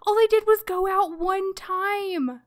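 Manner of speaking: high, loud wail of disbelief; distraught lament. Real, not acted. Player lamenting good parents losing their child, distraught.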